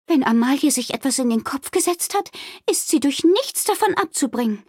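high-pitched innkeeper voice, reasoning; pondering Wilma. A narrator's reading. Wilma pacing the guest room, pondering. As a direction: think it through in a high character voice. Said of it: "überlegte sie".

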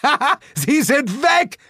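high, loud gloating ha-has; exultant full-voiced cheer; triumphant shout. A narrator's reading. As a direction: crow with joy that they're gone. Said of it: "rief ich".

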